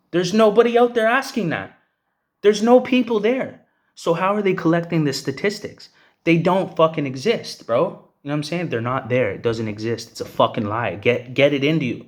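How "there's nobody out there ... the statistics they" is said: high, loud and furious, slowing down on the second line; real outrage, shouting the point home; angry shout. Real, not acted. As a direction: yell it out, fed up with the lies. Angry rant about fake statistics.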